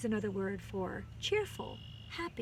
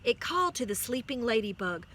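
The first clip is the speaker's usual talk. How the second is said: plain reading voice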